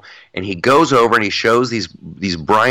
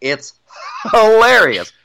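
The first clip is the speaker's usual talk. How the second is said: higher, laughing through the word; 11 semitones higher, 4 dB louder than usual. Real, not acted. Co-host delighted by the parody videos, laughing. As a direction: crack up while saying it.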